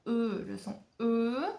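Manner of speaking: "E" held long as one isolated vowel; drawn-out vowel sound. Shown as a demonstration. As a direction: stretch each "E" slowly to show the sound. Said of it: "ils font un E comme ça".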